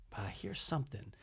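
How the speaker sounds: very low, soft undertone; hushed suspicion. Retold or imitated. Storyteller listening at his friend's door, hushed and suspicious. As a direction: drop right down as if listening in.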